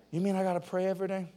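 quick, soft mock question; a hint of a whining kid; mock whiny teen. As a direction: imitate a reluctant teenager. Mimicking a doubting teen.

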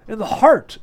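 high, loud burst of surprise; startled exclamation. A narrator's reading. Margarita reacting to the marksman's boast, exclaiming. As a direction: exclaim it, pitch jumping up. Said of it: "exclaimed Margarita".

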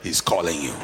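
hushing crowd. Acted.

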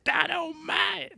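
very high, slow squeak; squeaky high voice. Pretend. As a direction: squeak it out in falsetto. Guessing what TNT stands for.

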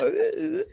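high showy dodging noises; teasing high-pitched hoots while dodging; taunting "uh, uh". Acted. Showing how Aang dodged everything, mock taunting oohs. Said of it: "así como".

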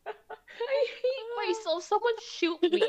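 high, drawn-out words through laughter; laughing. Real, not acted. Cringing at herself with friends, laughing. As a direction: groan it out while laughing.

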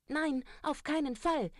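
very high, loud refusal; pigs shouting no. Acted. Three pigs shout their refusal at the wolf, very high and loud. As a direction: cry it out up high. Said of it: "riefen die drei kleinen Schweinchen".

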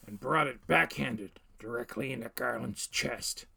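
ordinary delivery

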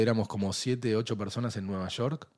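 ordinary talk, nothing marked

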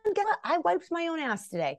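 high, triumphant self-congratulation; elated gratitude. Acted. Bragging about a tiny daily victory, high and elated. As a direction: announce a small win in a high, delighted voice. Voicing gratitude for small moments.

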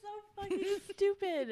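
real helpless laughter, voice pitched high; cracking up, out of breath; breathless laughing. Real, not acted. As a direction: gasp the words out between laughs. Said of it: "I can't breathe".

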